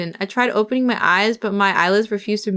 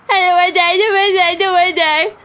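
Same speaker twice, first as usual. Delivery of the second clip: desperate pleading; 8 semitones higher, 4 dB louder, faster than usual. Acted. Begging thugs for his life.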